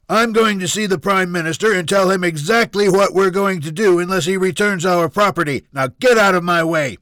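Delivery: loud, high, defiant rant; angry snapping. Acted. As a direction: shout them out of the way. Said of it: "Junior snapped".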